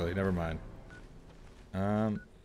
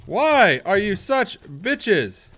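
angry yelling; 5 semitones higher, 4 dB louder, much slower than usual. Real, not acted. Still fighting off the ticks.

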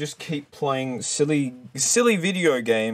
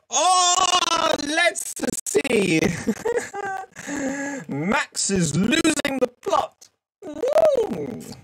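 very high, silly squeal; hammed-up falsetto, coming loose; losing the plot; 14 semitones higher, much slower than usual. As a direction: squeal it far above your usual voice, unhinged. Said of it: "I don't know what that was".

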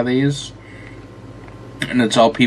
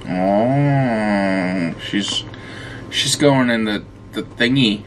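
usual speaking voice

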